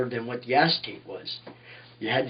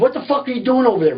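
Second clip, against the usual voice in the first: higher, louder and fast angry yell; furious yell; 10 semitones higher, 5 dB louder, faster than usual. Retold or imitated. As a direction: tear into him, rapid-fire. George yelling at the narrator.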